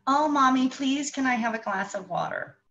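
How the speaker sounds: put-on high, polite begging child; sweet pleading child. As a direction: ask sweetly like a little kid. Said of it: "Maybe they'll say".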